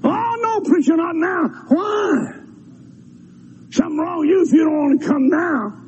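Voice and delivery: high shouted protest; a listener crying out that Jesus should not come now; reluctant listener. Retold or imitated. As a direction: beg the preacher to wait. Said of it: "voicing a reluctant listener".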